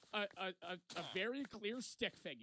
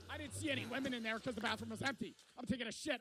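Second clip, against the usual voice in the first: ordinary talk, nothing marked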